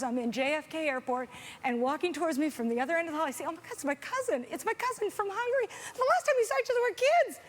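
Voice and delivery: ordinary talk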